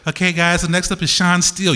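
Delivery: high-pitched imitation of an emcee; host introduction. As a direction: announce the next act to the crowd. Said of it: "She's like".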